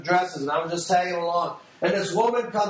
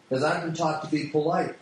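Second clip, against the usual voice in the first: normal talking voice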